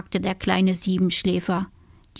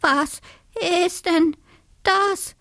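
high stammer climbing word by word; frightened cry; 11 semitones higher than usual. A narrator's reading. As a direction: stutter the first word, then halting squeaks. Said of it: "rief der kleine Siebenschläfer".

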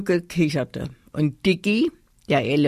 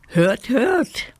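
high, curious doll voice; curious doll calling out; 8 semitones higher than usual. A narrator's reading. Doll Amanda reacting to the teddy's news, high curious call. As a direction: pipe up high, all curiosity. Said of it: "rief die Puppe Amanda und klapperte neugierig".

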